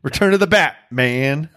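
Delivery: usual speaking voice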